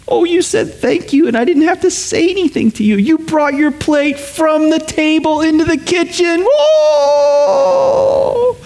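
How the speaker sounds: gushing parent. Retold or imitated. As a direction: overdo the praise to a small child. Parent gushing praise to child.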